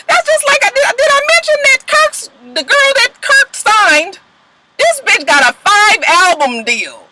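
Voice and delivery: high and heated, slowing at the end; high-pitched gossip rant; worked-up rant. Real, not acted. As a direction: pile on the outrage in a high voice. Ranting in disbelief.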